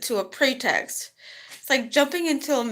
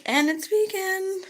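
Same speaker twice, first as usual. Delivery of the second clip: proud reveal; 13 semitones higher than usual. Retold or imitated. Proud reveal, very high, slow.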